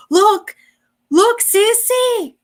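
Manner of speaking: high, excited little brother calling out; a small kid tugging at his big sister; little boy voice. Retold or imitated. Little brother calls out to his big sister, high and eager. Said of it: "just like that".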